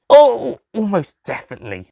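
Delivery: doctor voice. Acted. Doctor answering.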